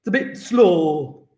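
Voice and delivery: slow, drawn-out Brummie accent. Shown as a demonstration. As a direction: drag it out in mock Brummie. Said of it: "don't really like the Brummy accent".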